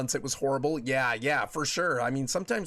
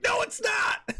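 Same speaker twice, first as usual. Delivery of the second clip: indignant shout; slower than usual. Retold or imitated. Husband denying wife's man-cold jab, high mock shout. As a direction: yell it in mock outrage. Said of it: "And I'm like".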